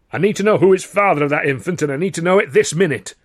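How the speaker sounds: loud, high, insistent demand; demanding angrily. Acted. Carno angrily demands to know the father, loud. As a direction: press him hard for the answer.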